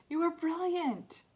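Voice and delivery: high, loud, drawn-out compliment; excited praise. A narrator's reading. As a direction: gush it slowly with pride. Dad praising daughter after show.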